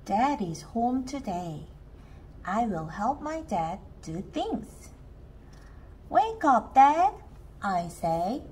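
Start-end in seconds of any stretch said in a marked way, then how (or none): 6.1-7.1 calling out. A narrator's reading. Child wakes sleeping dad.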